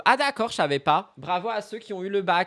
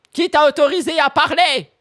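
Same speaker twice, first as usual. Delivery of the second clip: higher and louder, a fake furious demand; mock outrage; 9 semitones higher, 7 dB louder than usual. Streamer fake-snapping at a chatter who dared to speak, mock outraged. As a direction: pretend to be outraged, then drop it. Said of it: "Non, je rigole".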